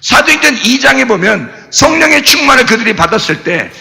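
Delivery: ordinary talk, nothing marked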